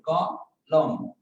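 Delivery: "ক", short pause, "লম"; two-step breakdown, "ক" then "লম"; syllable by syllable. Shown as a demonstration. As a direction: sound out "ক" and "লম" separately. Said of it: "এইভাবে কিন্তু উচ্চারণ করি".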